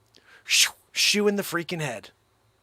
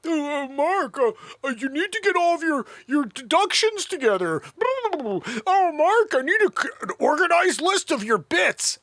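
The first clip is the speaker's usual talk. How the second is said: higher, hesitant nagging impression; imitating Joanne; 10 semitones higher, 3 dB louder than usual. Mocking Joanne asking Mark for his deductions, a higher nagging voice. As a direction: lightly play the fussy accountant. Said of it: "Joanne, you're not funny at all".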